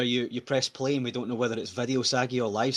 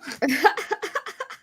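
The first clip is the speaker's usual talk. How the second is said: a high burst of laughter. Real, not acted. Laughing at the joke.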